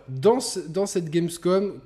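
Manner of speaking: usual speaking voice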